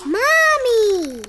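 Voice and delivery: calling out. Acted. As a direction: cry out to his mother. Child calling for mother.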